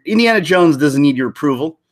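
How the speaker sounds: ordinary talk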